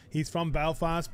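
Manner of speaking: ordinary talk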